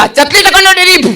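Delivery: high, cocky puppet voice; cheeky puppet. Acted. Puppet eggs the crowd on against host, cheeky puppet voice.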